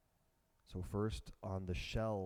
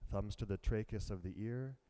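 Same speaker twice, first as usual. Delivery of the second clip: ordinary talk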